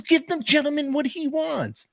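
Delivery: very high-pitched mock lady voice; lady's indignant order. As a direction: imitate a lady stepping in, high and insistent. Acting out lady intervening for him.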